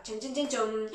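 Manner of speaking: low, soft "chon chon"; suspense drumroll. Pretend. Teacher searching for words with le, suspense drumroll. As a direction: murmur a mock drumroll, low in pitch.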